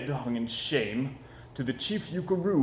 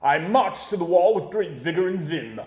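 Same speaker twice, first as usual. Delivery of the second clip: ordinary delivery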